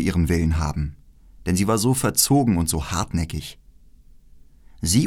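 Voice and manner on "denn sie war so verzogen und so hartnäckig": ordinary delivery, nothing marked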